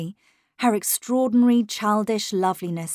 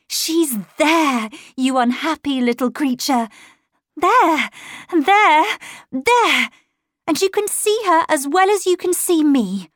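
raised, louder, insistent voice; agitated outcry; 7 semitones higher, 7 dB louder than usual. A narrator's reading. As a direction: cry it out urgently, higher and louder. Governess confronts the child, pointing.

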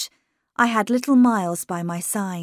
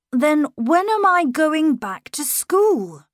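put-on high, louder, slow boy voice, pressing; 8 semitones higher, 6 dB louder, slower than usual. A narrator's reading. Miles pressing his governess about school, high and slow. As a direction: keep pushing the question.

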